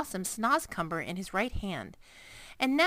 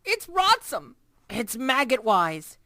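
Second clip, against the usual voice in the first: higher, louder, revolted; disgusted; 5 semitones higher, 7 dB louder than usual. A narrator's reading. Giant telling Sophie how vile the Snozcumber is. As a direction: spit out each word in disgust.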